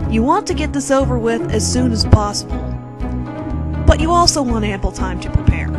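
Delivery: plain reading voice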